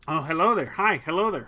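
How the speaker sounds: ordinary delivery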